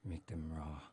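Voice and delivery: a hint of Peter Lorre menace; lightly voiced Lorre. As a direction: Ren ordering raw hamburgers in a hint of Lorre. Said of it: "Peter Lorre".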